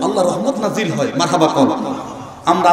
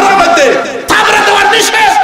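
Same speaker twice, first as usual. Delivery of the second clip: high, loud proclamation; emphatic preaching shout; 12 semitones higher, 4 dB louder than usual. Real, not acted. Preacher forbidding slaps to the face, high emphatic shout. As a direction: shout the ban to the crowd.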